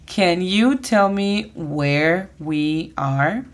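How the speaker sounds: drawn out, every word of "Can you tell me where we are?" separate; very slow model. Shown as a demonstration. Teacher repeating the phrase very slowly for learners. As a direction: say it at half speed. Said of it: "la pronunciación fonética es".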